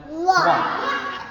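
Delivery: high, laughing little quack; a giggly duck's quack. Acted. First duck quacks.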